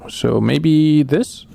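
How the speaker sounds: ordinary delivery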